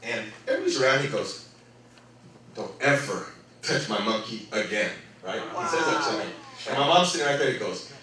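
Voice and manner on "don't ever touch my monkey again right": very low, soft warning; retold quiet threat. Head trainer warning the boy, acted quiet threat. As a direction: imitate a man menacing a child under his voice.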